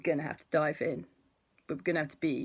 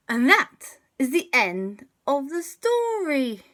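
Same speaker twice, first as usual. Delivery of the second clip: 1 cheerful sign-off; 12 semitones higher, 8 dB louder than usual. Real, not acted. Signing off story to child.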